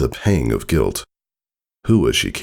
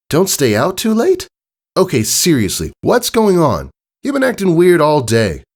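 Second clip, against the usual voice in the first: put-on much higher young filly voice; Scootaloo's voice; 13 semitones higher, 4 dB louder than usual. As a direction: raise the voice high like a young pony.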